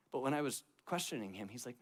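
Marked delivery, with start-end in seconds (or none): none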